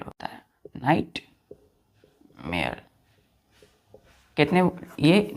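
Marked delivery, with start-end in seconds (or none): 0.8-2.8 whispered. Shown as a demonstration. Teacher repeating the word to students, slow whisper. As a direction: whisper nightmare slowly, almost breathless and lower.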